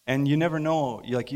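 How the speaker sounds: usual speaking voice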